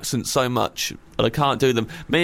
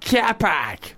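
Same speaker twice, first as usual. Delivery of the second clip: put-on slightly higher try at a Boston accent; attempted Boston accent; 5 semitones higher than usual. Copying the guest's car park, attempted Boston accent. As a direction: have an amateur go at Boston. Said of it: "I can't really do it".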